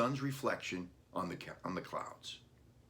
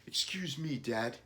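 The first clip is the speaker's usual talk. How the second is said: put-on a touch higher, young boy holding back tears; hurt little boy; 6 semitones higher than usual. A narrator's reading. Billy voiced by reader.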